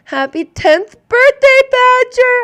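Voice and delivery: high, loud, emotional cheer; excited birthday wish. Real, not acted. Mother wishing her daughter happy birthday, excited and high.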